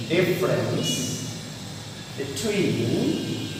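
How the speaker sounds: word by word with long pauses; spaced-out phrases for someone writing them down; slow dictation. Real, not acted. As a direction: dictate it softly, waiting after each chunk. Dictating the home task to pupils.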